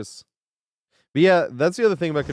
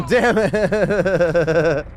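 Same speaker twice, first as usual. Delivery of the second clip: loud curse through a laugh, higher; 6 semitones higher, 7 dB louder than usual. Real, not acted. Streamer cursing a missed play, laughing loudly. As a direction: swear and laugh at yourself at once. Laughing at own mistake in game.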